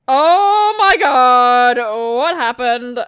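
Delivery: loud, very drawn-out exclamation; shocked cry. Acted. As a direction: gasp it out slowly in big shock. Acted shock on arriving.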